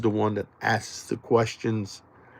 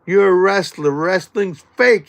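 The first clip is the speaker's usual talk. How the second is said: higher, louder mocking jab; taunting DDP; 6 semitones higher, 5 dB louder than usual. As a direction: needle the big wrestler to his face. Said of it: "I said to him".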